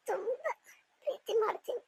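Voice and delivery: fading breathless confession from a hospital bed; faint weak voice. Acted. Weak patient tells husband she loves him. (ಕ್ಷೀಣ ಮೆಲುದನಿಯಲ್ಲಿ)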